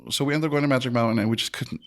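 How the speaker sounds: ordinary delivery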